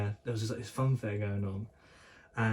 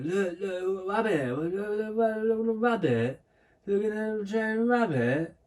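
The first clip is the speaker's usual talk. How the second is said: put-on much higher excited toddler pointing; excited little kid shouting at a rabbit; high, eager mock-toddler voice, slow at the end; imitating himself as a toddler; 10 semitones higher, slower than usual. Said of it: "of when I was a kid on the train".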